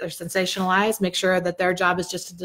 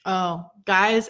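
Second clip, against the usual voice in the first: ordinary talk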